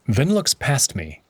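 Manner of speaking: ordinary delivery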